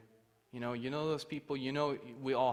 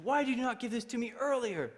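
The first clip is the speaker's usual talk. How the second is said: high, loud scolding question; indignant police chief; 12 semitones higher, 5 dB louder than usual. Retold or imitated. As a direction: imitate an exasperated chief demanding an explanation.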